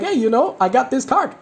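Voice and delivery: high, light, playful voicing; mock casual brag. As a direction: act out showing off a card. Mock showing card to opponent.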